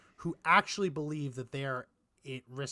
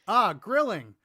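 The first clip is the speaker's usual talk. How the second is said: hammed-up delight, higher and louder; mock enthusiasm; 4 semitones higher, 6 dB louder than usual. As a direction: parody an eager committee pitching ideas. Mimics committee pitching ad ideas.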